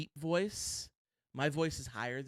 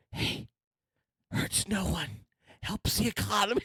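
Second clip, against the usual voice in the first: lightly voiced old politician, a bit higher; a hint of a folksy president; Joe Biden impression; 5 semitones higher than usual. Co-host riffing on kink shaming, mock Biden voice. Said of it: "I sound like Joe Biden".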